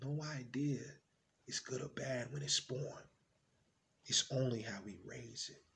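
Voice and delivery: soft, measured fatherly reassurance; gentle dad. Acted. Dad reassuring his doubting son, gently. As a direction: settle down into quiet, patient explanation. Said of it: "He says".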